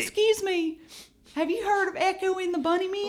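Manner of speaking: high-pitched attempt at a Midwestern accent. Asking about a band in a mock Midwest accent. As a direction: put on a high, quick Midwest voice. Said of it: "trying to do a Midwest".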